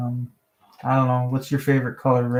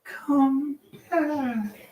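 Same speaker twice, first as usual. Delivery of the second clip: soft, high mock plea; pleading girlfriend; 8 semitones higher, 8 dB quieter, much slower than usual. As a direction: beg him softly to return. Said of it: "she's like".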